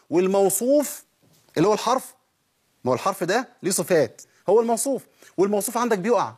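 ordinary talk, nothing marked

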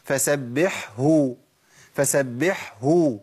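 فسبحه with the final ه clearly heard, slow. Shown as a demonstration. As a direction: sound the ه of فسبحه. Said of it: "لازم أظهر الهاء". (إظهار الهاء في فسبحه)

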